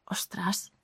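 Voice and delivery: breathy stunned whisper of Ostras. Retold or imitated. Recalling her shock to the hosts, in a hushed whisper.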